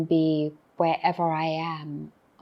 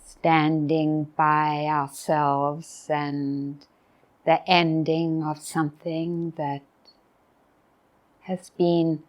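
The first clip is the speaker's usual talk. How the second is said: guided meditation; 2 semitones lower than usual. Real, not acted. Teacher inviting retreatants to be present at the closing morning, guided meditation. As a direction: invite them into stillness, with long pauses. Leads a reflective pause.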